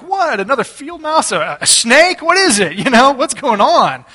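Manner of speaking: acted-out exasperated alarm; alarmed questioning. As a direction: fire off startled questions. Acting his reaction to second shriek.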